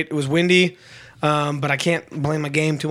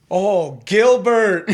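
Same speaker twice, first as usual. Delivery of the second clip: loud, raised call of recognition; mock drunk holler; 4 semitones higher, 5 dB louder, much slower than usual. Drunk traveler spots a local celebrity, loud mock holler. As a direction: call out like a drunk spotting someone famous. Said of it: "he looks up and goes".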